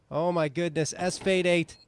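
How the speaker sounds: quick live play-by-play call; esports caster. Real, not acted. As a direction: narrate the round as it unfolds.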